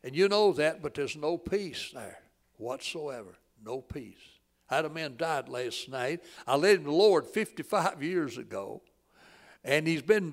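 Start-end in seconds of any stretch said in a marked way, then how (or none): none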